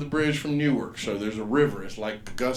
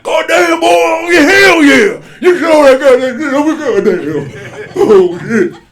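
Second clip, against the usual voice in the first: rowdy hype; 14 semitones higher, 18 dB louder, slower than usual. Retold or imitated. Rowdy friends cheering him on, hollering. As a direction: whoop it up like a rowdy drunk buddy.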